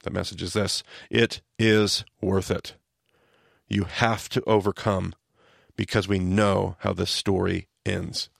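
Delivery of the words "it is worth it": slow. Real, not acted. Key message, said slowly.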